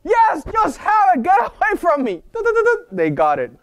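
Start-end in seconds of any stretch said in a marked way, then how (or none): 0.0-2.2 high shouted give-in; exasperated shout. Retold or imitated. Fed-up adult giving in. 2.3-2.9 triumphant singsong. Retold or imitated. Mock triumph after getting it.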